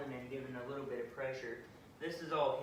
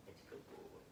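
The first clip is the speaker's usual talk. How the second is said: soft whisper of praise to the dog; 15 dB quieter than usual. Real, not acted. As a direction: breathe the praise so he stays calm. Praising dog who came close.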